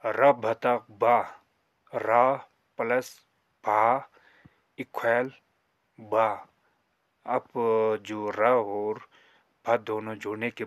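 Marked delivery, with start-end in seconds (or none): 0.1-6.5 रधतकदा, slow and louder, each part stressed; slow loud demo reading. Shown as a demonstration. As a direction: call the syllables out to the class. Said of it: "र बतक ब करके पढ़ते हैं". (धीमे और ज़ोर से रधतकदा पढ़ना)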